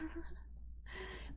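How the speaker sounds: quiet airy chuckle; airy laugh. Real, not acted. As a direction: laugh lightly at yourself. Laughs at two hours.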